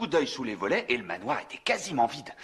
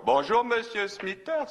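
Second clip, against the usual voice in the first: a slow call across the hedge, higher and drawn out; 8 semitones higher, slower than usual. Retold or imitated. Bert greeting his neighbour across the hedge, a slow drawn-out call. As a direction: call over to the neighbour politely but slowly. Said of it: "je crie dans sa direction".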